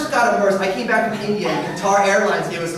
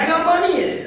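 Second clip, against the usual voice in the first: put-on much higher, louder little boy; child voice; 11 semitones higher, 6 dB louder than usual. Son boasting to dad about his money, high child voice. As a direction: boast like a kid with treasure.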